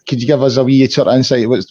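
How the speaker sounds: usual speaking voice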